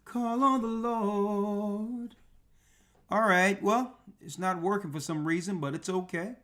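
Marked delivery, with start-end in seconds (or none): 0.0-2.1 slow intoned call. Acted.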